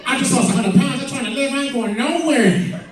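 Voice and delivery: lightly voiced late rapper.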